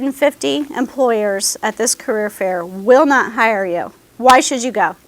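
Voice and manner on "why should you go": ordinary delivery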